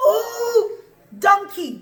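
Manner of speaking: high, exasperated storybook scolding; exasperated "ooh" at a stubborn animal; cross scolding. Acted. Angry Bilam scolds his donkey.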